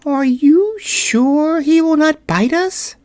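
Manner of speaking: high, slow, tiny voice of a nervous mouse; a frightened little queen peeking out; timid mouse queen. Acted. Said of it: "asked in a timid voice".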